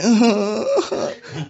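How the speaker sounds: a high, amused laugh; real high laugh. Real, not acted. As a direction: laugh at the absurdity. Pastor laughs at the story.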